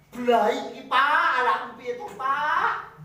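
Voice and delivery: a shocked mother begging for answers; imitating a dismayed parent. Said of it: "orang tuanya ngomong apa". (ibu kaget dan panik bertanya pada suami, suara tinggi dan keras)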